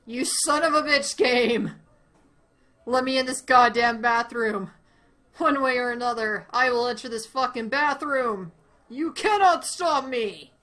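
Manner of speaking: loud raging demands; furious yelling. Real, not acted. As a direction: rant at full volume. Raging at game.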